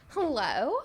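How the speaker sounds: put-on perky hello, lifted at the end; friendly phone hello. Shown as a demonstration. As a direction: answer as if it's a favorite friend. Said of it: "But if it's Sarah, I'm like".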